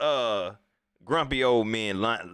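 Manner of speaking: ordinary talk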